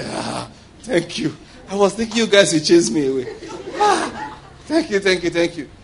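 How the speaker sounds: lightly voiced demon, laughing thanks; mock grateful demon. Demon thanks the people for not chasing it, mock grateful. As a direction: play a pleased little demon left in peace. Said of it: "The demon say".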